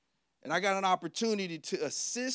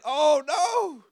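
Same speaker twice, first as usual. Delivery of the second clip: starstruck shout; 10 semitones higher, 9 dB louder than usual. Retold or imitated. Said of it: "I said".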